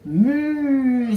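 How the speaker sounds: loud, high mooing; cow moo. Acted. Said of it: "said Mister Cow".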